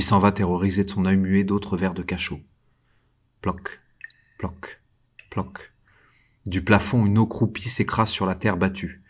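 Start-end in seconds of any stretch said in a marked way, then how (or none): 3.4-5.7 soft, fading drip sounds, each one quieter; dripping water. Acted. Prisoner hearing water drip in his cell, soft fading imitation. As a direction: mimic water drops falling in the dark.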